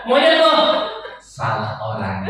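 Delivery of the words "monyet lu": mock insult. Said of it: "Ketika dia ngomong".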